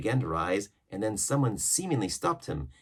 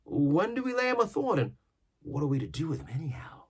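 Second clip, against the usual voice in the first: put-on young sailor; 8 semitones higher than usual. A narrator's reading. Richard voiced.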